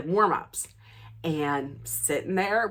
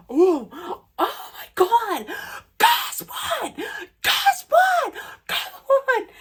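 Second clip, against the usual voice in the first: very high, loud squealing; ecstatic shriek; 4 dB louder, slower than usual. Retold or imitated. Fan shrieking to Lisa after hanging up. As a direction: scream the news to your friend.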